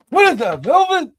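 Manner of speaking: put-on high, loud old man voice; movie old man bit; Eddie Murphy old man. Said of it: "That guy".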